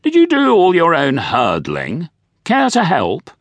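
high, cheery posh doctor. A narrator's reading. Doctor offers Nigel gloves.

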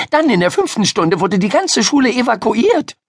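put-on high girl's voice, evakuiert said in one flow; excited storyteller girl. Miriam telling Ida of the evacuation, evakuiert unbroken, excited. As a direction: tell it eagerly, running straight through evakuiert.

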